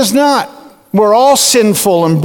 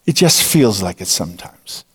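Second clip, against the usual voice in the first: soft aside; 6 dB quieter than usual. Acted. Aside in low hush.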